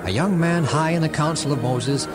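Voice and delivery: ordinary delivery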